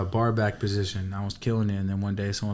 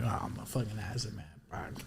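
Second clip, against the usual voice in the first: dazed mutter; 4 semitones lower, 16 dB quieter than usual. Retold or imitated. Mumbling to himself while tripping.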